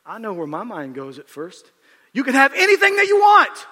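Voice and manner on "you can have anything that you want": high, loud, gleeful mock craving; mock greedy shout. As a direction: shout it like a kid wanting everything. Preacher voicing a greedy wish.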